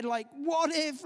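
hammed-up anxious shout; panicked what if. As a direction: fling out the fear. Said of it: "It's the voice of what ifs".

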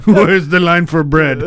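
fake Russian accent. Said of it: "right in Russian".

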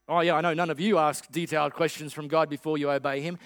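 ordinary delivery

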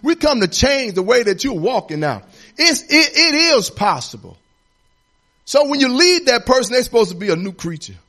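rising, loud preacher's cadence; building sermon shout; preaching at full force. Acted. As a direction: preach it to the congregation.

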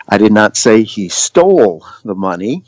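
"stole" stretched and emphasized, rest lighter; stress on STOLE. Shown as a demonstration. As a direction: lean hard on the word "stole". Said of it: "the different emphasis".

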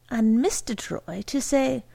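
ordinary delivery

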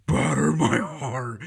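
slow, very loud roared verse line; roaring recitation. Retold or imitated. Professor roaring Donne into the mic, slow and loud. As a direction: bellow each word as if losing your faith. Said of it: "this roaring of this religious poem".

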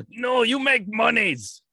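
high, slow mock Russian handler; Russian accent. Russian handler refusing to let Fedor retire, Russian accent. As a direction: ham up a drawn-out Russian accent. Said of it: "That's my best Russian accent".

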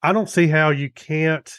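normal talking voice

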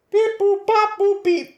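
high robotic beeps; toy robot voice. Acted. Imitating random number generator.

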